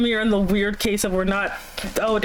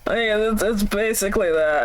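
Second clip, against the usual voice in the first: usual speaking voice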